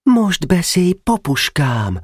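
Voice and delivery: put-on a small boy suddenly brave; young Nemecsek piping up; eager boy.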